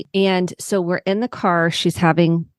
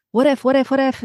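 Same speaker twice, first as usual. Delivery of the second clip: anxious what-if voice; 6 semitones higher, faster than usual. Shown as a demonstration. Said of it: "versus the".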